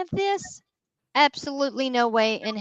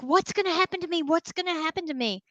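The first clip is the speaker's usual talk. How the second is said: fast, higher, mock panicked plea; retold panic; 6 semitones higher, 3 dB quieter, faster than usual. Teller imitating the scared kid on the bridge, mock panicked. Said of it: "And he's freaking out".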